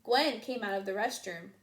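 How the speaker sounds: usual speaking voice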